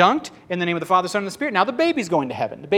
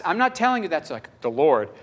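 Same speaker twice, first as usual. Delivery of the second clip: usual speaking voice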